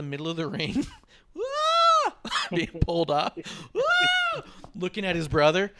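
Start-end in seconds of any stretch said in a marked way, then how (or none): 1.4-2.2 high, loud mock scream; comic high-pitched yelp; imitated scream. Imitates wrestler dragged into the ring. 3.8-4.4 very high, loud mock wail; sustained comic shriek of someone dragged off; mock high scream; imitated scream. Imitates wrestler being pulled away.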